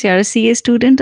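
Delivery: usual speaking voice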